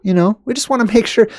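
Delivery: high, airy mocking voice; mock official reassurance. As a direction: play down the danger in a high pitch. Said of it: "And they're gonna say".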